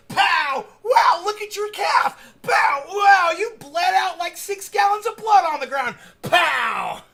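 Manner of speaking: high, loud hammed-up ringside shouting; mock fight announcer yell. Recapping the dog's bloody fight for viewers, hammed-up announcer shouting. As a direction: yell each hit like a wild fight commentator.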